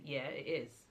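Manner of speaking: a hint of a dismissive vendor; imitating the rude vendor, just a touch; curt attitude. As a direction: lightly mimic a curt, offhand reply. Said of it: "Why are you giving me attitude?".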